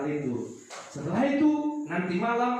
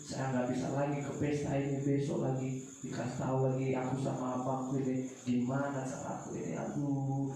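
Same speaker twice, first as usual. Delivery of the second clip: soft, quieter mock weeping child; crying child; 5 semitones lower, 6 dB quieter than usual. Child can't go to the party, softly weeping. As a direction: lament softly like a child near tears. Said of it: "Nangis-nangis dia".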